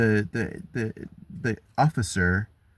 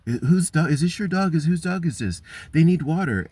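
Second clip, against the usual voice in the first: higher, fussy mock old-lady voice calling out; nosy old neighbors; 7 semitones higher than usual. Mocking the old people at her car, in a high fussy voice. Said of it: "I can hear them from my".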